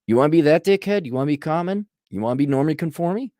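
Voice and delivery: ordinary talk, nothing marked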